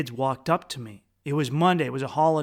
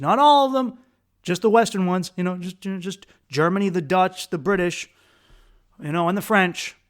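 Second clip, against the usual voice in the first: louder, higher ranting list; heated rant; 4 semitones higher, 6 dB louder than usual. Real, not acted. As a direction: rattle them off with rising irritation. Heated rant about Europeans.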